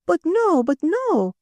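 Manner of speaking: high-pitched French protest; crying out in protest. A narrator's reading. Madame overruling the editor, excitedly protesting. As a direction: cry it out high, brushing objection aside. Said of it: "cried madame".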